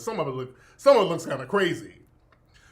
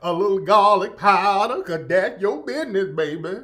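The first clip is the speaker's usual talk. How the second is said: exaggerated Southern accent; 7 dB louder than usual. Streamer mimicking a cooking influencer, exaggerated Southern accent. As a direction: lay the Southern accent on thick, like a TikTok cook. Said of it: "But that accent is very extra".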